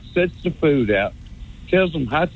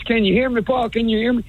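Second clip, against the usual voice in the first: anxious caller imitation; 7 semitones higher, faster than usual. Mimics a caller finally on air.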